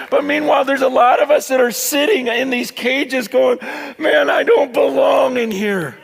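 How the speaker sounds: high, loud, shouted preaching climax; preaching at full force. Acted. Pastor rousing his church to leave the cage: full-force shouted preaching. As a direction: preach it to the rafters.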